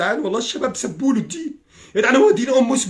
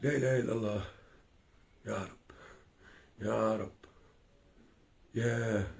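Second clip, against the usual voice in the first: much softer and lower, murmured under breath; quiet despairing prayer; 11 semitones lower, 17 dB quieter, slower than usual. Real, not acted. Grieving for his country, murmured quietly to God. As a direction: sigh a prayer to yourself, spent.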